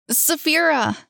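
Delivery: high, loud calling of her name. Acted. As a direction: call her name through the house. Boy calls for missing sister.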